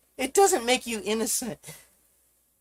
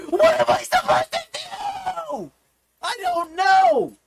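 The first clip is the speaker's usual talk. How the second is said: desperate wail; 13 semitones higher than usual. Real, not acted. Streamer at wits' end on livestream, screamed despair. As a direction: stretch it into a frantic, helpless wail. Desperate rant reaches its peak.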